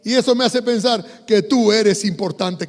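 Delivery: ordinary talk, nothing marked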